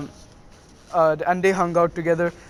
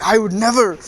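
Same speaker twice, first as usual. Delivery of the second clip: higher-pitched mock-indignant protest; flustered denial; 4 semitones higher, 4 dB louder than usual. Neji denies Naruto's teasing about his teammate, mock indignant. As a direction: act out an embarrassed, offended denial. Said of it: "Neji's face went red".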